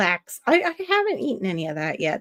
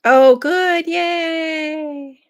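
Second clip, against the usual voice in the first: excited; 9 semitones higher, 7 dB louder, much slower than usual. Real, not acted. Cheering a viewer's fixed computer.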